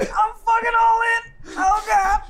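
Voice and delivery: high, slow crying shout; hammed-up all-in howl; mock anguish. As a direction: wail like a ruined gambler. Mock gambling despair.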